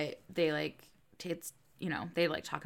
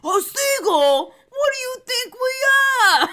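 shouted, high, drawn-out mock outrage in a Boston accent; too-Boston Rhode Island accent; 14 dB louder, slower than usual. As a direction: badly imitate offended Rhode Island locals, sounding Boston. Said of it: "not a Rhode Island accent. That was way too Boston".

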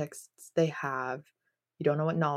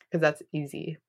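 ordinary delivery

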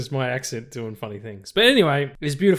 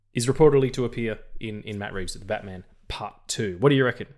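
ordinary delivery

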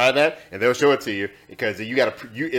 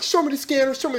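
mock-tough challenge in high pitch; retold cocky demand. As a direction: imitate yourself acting hard with a cop. Retells challenging police officer.

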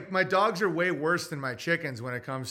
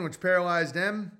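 usual speaking voice

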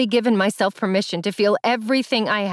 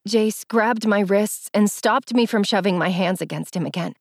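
ordinary delivery

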